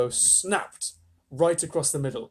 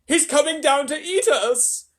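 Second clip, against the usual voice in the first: slow, high, loud wail; frightened wail; 12 semitones higher, 7 dB louder than usual. A narrator's reading. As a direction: wail in terror of the giant. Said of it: "wailed the old green grasshopper".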